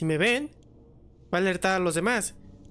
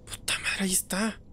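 susurrando; 4 dB quieter than usual. Real, not acted. Escondido del enemigo.

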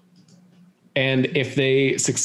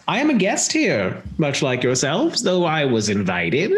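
theatrical villainess defending herself; indignant hostess villain; 3 semitones higher than usual. Acted. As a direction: play an affronted guest putting on airs. Game master plays the villain.

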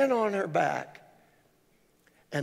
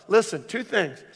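ordinary talk, nothing marked

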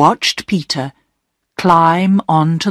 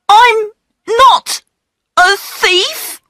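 put-on high, halting protest of a caught boy; unsure denial; 4 dB louder, slower than usual. A narrator's reading. Peter held by the station master, unsure denial. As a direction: deny it haltingly in a high child's voice. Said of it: "did not sound very sure about it".

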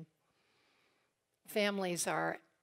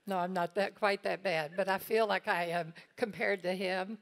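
usual speaking voice

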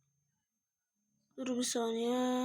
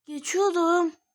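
louder, higher protest; indignant outcry; 5 semitones higher, 6 dB louder, slower than usual. Real, not acted. Player protesting losing to the game, indignant outcry. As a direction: cry out at the unfair death.

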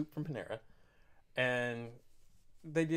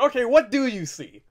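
loud, high, fed-up question; barely holding patience; exasperated loud; 6 semitones higher, 10 dB louder than usual. Retold or imitated.

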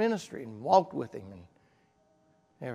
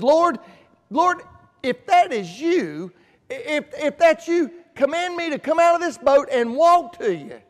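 Peter's frightened plea, higher and louder; fearful cry; 8 semitones higher, 8 dB louder than usual. Retold or imitated. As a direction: call out to the Lord in fear, pleading. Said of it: "Peter yells out in fear".

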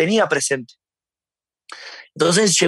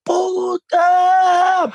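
sudden high, loud yelled outburst; sudden shouted tic; 13 semitones higher, 4 dB louder than usual. Retold or imitated. Man with Tourette's yelling at passing women, sudden high shouted tic. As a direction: blurt it out as an involuntary tic yell, pitched way up. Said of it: "cuando pasaron por al lado grité".

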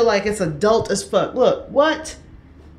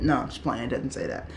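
much softer and lower, a quiet confession; sheepish mumble; 10 semitones lower, 10 dB quieter, much faster than usual. Real, not acted. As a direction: drop it under your breath, caught out. Confesses the fake letter.

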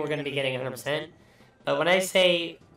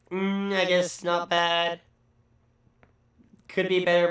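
ordinary talk, nothing marked

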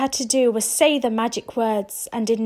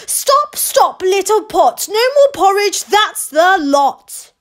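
higher, louder commanding shout; shouting the spell; 8 semitones higher, 8 dB louder than usual. Acted. Rose shouting the magic words at the pot. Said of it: "Rose took one look and shouted".

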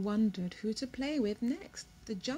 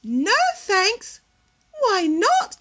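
haughty peacock; 9 semitones higher, 12 dB louder, slower than usual. Acted. Peacock voice, high loud.

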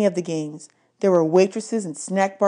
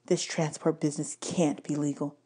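quiet; 3 semitones lower, 9 dB quieter than usual. A narrator's reading. Annabeth whispering in the trailer.

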